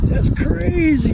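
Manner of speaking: high, slow, stretched-out wonder; jaw-dropped amazement; amazed. Real, not acted. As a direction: marvel at the beast. Amazed at huge turtle.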